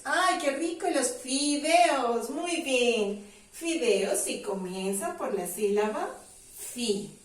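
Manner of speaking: ordinary talk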